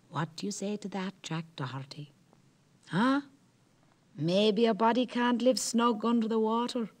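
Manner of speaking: a hint of a smug old Merrow; lightly voiced boastful Merrow. Merrow brags to Jack about his cellar, lightly smug.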